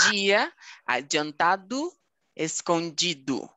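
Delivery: slow "dia adiantado, escondido", each DI as "jee". Shown as a demonstration. Teacher models slowly, "dia adiantado, escondido" with DI as "jee". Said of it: "vai ganhar a fonética".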